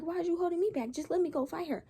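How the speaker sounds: indignant protest. Retold or imitated. Held back by librarians, protesting.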